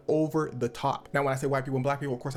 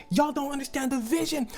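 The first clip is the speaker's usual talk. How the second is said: higher put-on arrogant voice; mock Shane rant; 10 semitones higher than usual. Shane cussing out people in series, mock pompous voice. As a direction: sneer it like a pompous director. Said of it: "he's just like".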